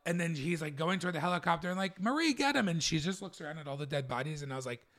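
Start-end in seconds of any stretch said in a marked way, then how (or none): 2.0-2.7 mock yell. Retelling shouted scene line.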